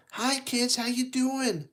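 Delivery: lightly voiced cheerful Thomas greeting. Thomas greeting the kids, lightly voiced cheerful engine. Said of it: "That's what Thomas says".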